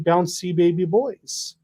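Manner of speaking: normal talking voice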